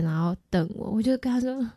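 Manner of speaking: normal talking voice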